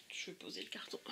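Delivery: soft whispered note to self; whispered aside. Real, not acted. Putting the box aside mid-video, whispered to herself. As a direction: whisper it while setting the box down.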